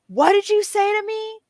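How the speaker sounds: high, loud mock shouting; mock angry confrontation. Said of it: "she's like".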